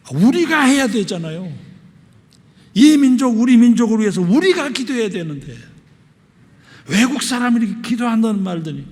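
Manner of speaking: loud, high-pitched exhortation; impassioned preaching. Real, not acted. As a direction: press it on the congregation with full force. Preaches with force.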